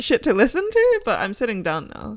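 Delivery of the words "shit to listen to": "Shit to listen to?" said much higher; rising high on "Shit to listen to?"; higher pitch. Shown as a demonstration. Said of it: "shit to what".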